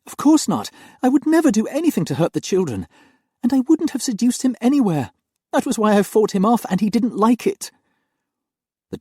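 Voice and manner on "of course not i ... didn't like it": high, loud, pleading denial; distressed young nanny. Acted. Nanny denies the charge to her mistress, high and distressed. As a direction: defend yourself in distress. Angelique defends herself.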